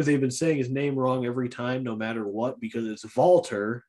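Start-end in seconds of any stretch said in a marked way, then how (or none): 3.2-3.8 Walter with the W said as a V; German-style Walter, opening on a V; German V sound. Shown as a demonstration. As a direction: say "Walter" as "Valter". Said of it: "pronounced with a, like, a V in German".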